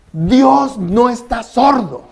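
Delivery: slow and loud, every word hammered; a preacher pounding home his main point; emphatic declaration. Real, not acted. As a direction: proclaim it firmly, word by word. Preacher's key statement.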